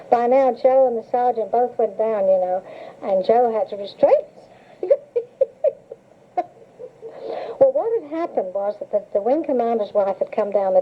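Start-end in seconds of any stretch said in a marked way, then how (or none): 5.0-5.9 short high laugh; amused high laugh; high giggle. Real, not acted. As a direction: giggle at the memory. Laughing at own story.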